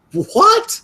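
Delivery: high-pitched disbelieving "what"; startled, incredulous question; shocked. Real, not acted. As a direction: jump up high in real shock. Shocked at hours just read out.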